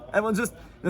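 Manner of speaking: ordinary talk, nothing marked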